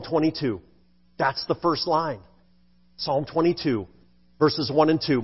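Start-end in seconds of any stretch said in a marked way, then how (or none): none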